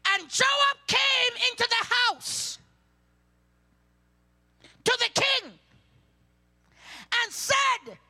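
high, loud, slow scripture shout; preacher's rising cry; fiery preaching. Acted. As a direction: thunder the verse out to the church. Preaching scripture at full force.

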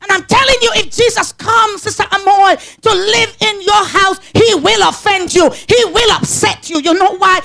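preaching. Acted. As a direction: drive it home to the pews. Preaching at full force.

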